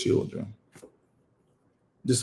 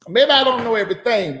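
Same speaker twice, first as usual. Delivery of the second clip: normal talking voice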